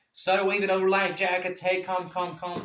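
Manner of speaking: high, urgent call to a distant boat. Retold or imitated. Calling boat over with life jackets.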